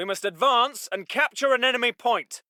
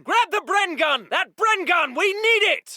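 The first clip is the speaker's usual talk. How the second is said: high, insistent shout; urgent shouted order; 7 semitones higher than usual. Acted. Officer needing the Bren gun grabbed, shouting urgently. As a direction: shout for the weapon.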